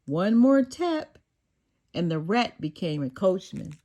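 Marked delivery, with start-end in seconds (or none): none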